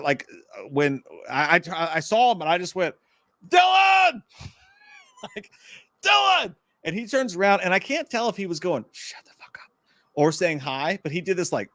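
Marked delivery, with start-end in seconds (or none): 3.5-6.5 high shouted name; a fan calling out to Dylan McDermott. Retold or imitated. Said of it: "something came out of me". 8.9-9.7 whispered hush; mouthing a reply across the ramp. Retold or imitated. Celebrity's mouthed reply retold.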